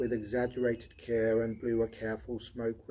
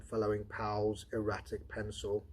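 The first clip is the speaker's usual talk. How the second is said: ordinary talk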